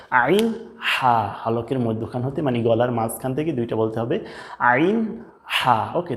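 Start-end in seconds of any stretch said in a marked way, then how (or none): 0.1-1.3 "আইন হা" slow from the middle of the throat; throaty pressed letters. Shown as a demonstration. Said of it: "চাপ দিয়ে বলতে হয়".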